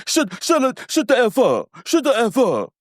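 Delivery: high-pitched mock outburst; mock upset. Recapper acts out Martell snapping at Maurice, mock upset. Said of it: "he gets so upset".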